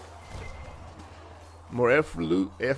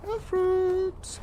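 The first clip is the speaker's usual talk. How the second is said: sing-song high. Real, not acted. Repeated item name, sung out.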